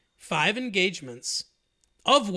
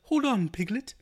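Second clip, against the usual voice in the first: Winnie the Pooh voice; 5 semitones higher than usual. Retold or imitated. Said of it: "Did he do the Winnie the Pooh voice?".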